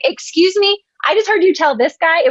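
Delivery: retelling the airport agent's unfair fee; indignant surprise. Retold or imitated. Airport customer to agent, imitating shocked indignation.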